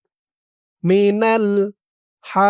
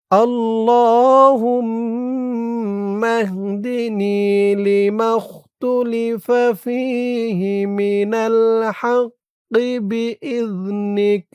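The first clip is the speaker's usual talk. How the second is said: drawn-out, melodic dua chanting; slow Quranic-style recitation of a prayer; slow Arabic recitation; 5 semitones higher, much slower than usual. Shown as a demonstration. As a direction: recite each syllable long and lilting. Recites the whole line.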